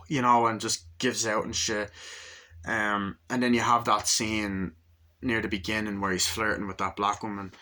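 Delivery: normal talking voice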